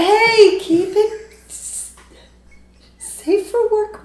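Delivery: high, drawn-out mock warning; playful scolding. Real, not acted. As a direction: tease them to keep it clean.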